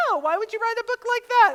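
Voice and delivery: high, loud mock-shocked young mom. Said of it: "it's only the young moms who are like".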